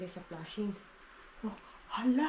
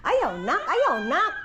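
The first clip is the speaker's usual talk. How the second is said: scolding no; 6 semitones higher, 8 dB louder, slower than usual. Real, not acted. Mother scolding kids fighting.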